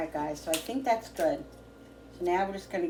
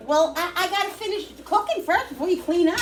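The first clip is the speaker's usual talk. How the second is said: raised voice across the house; calling from far away; 12 semitones higher, 7 dB louder than usual. Real, not acted. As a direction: call it out to the next room. Calling back to family member.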